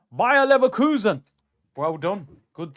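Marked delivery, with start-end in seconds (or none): 0.1-1.2 big loud name call; loud announcement. Real, not acted. Announcing the opponent.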